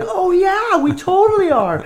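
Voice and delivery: bright high mimic of girls going along with the joke; playing-along girls. Girls playing along with the prank, high mock voice.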